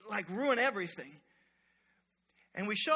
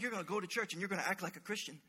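hissed threat; 3 semitones lower, 7 dB quieter, faster than usual. Retold or imitated. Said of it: "you're still yelling".

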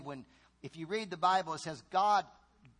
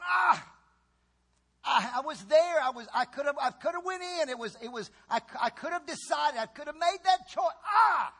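high, loud, despairing lament; lost soul crying out in regret, loud and high; anguished regret; 8 semitones higher, 6 dB louder than usual. Acted. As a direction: wail as someone who missed their chance. Damned soul regrets forever.

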